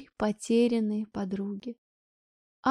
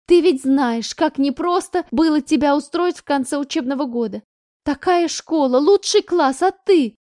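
building louder and higher to the end; angry scolding; 4 semitones higher, 6 dB louder than usual. Acted. Mother scolds daughter over the new school, rising shout. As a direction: scold her, rising in outrage.

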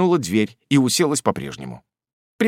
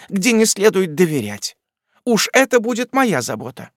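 domineering aunt; 9 semitones higher than usual. A narrator's reading. Miss Betsey declaring herself godmother, domineering. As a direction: lay down the law kindly but firmly.

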